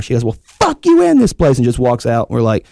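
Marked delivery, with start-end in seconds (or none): 0.2-1.6 mock angry lady. Woman storming out, voiced.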